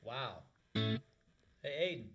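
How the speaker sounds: usual speaking voice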